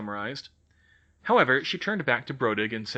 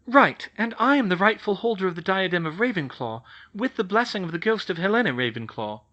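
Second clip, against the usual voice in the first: lightly voiced young witch, higher; Hermione's voice sketched a touch higher; a hint of a brisk schoolgirl; 9 semitones higher, 5 dB louder than usual. Hermione claims the diadem.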